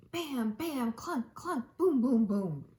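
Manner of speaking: loud, growing boom noises; mock piano bangs. A narrator's reading. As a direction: bang out the piano sounds. Kitten banging the piano.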